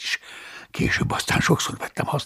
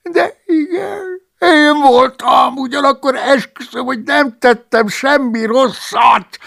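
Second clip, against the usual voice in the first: much higher, slow, half-crying confession; high whining monk; 13 semitones higher, 6 dB louder, slower than usual. Acted. The cellarius confessing to Paulus, whimpering. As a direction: admit it through a whimper.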